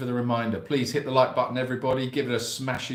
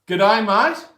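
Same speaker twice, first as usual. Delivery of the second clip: put-on Australian accent; 9 semitones higher, 10 dB louder, slower than usual. Greeting Sydney viewer.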